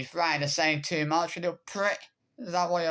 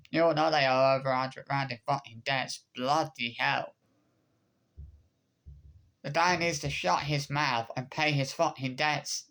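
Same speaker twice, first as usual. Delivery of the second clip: ordinary talk, nothing marked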